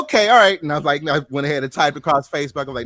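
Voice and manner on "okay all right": high, loud happy okay; excited relief. Retold or imitated. Reacting as his hurt friend nods, loud excited relief. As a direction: burst out relieved and pleased.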